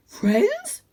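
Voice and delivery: high hopeful dog question; panting. A narrator's reading. Dog panting hopefully at the word friends. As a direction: pant it out eagerly. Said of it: "panted Cludge".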